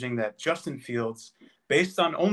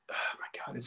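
exasperated whisper; 12 dB quieter than usual. Real, not acted. Frustrated trying to explain point.

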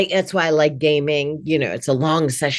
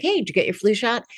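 cheery cold call; 6 semitones higher, 3 dB quieter, faster than usual. Shown as a demonstration. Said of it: "like cold calling".

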